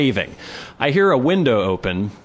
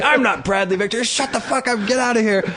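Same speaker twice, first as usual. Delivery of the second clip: high yelled retort from a window; yelling neighbor; 10 semitones higher than usual. Retold or imitated. Neighbor yelling back at the homeless man. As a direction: shout him down from above. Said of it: "And the guy's like".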